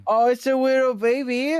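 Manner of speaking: cooing. Real, not acted. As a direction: gush over a cute pet. Coos over pet.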